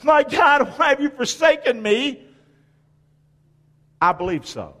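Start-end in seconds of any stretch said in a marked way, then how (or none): 0.0-2.2 high, raised cry to God; anguished cry. Acted. Preacher voicing Jesus crying on the cross, anguished cry. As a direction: cry out as one forsaken. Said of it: "when he cried".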